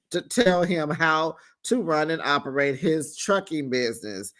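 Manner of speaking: normal talking voice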